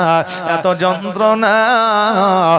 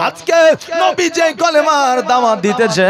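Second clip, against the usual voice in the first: fervent shout; 9 semitones higher than usual. Acted. Bilal proclaims his faith.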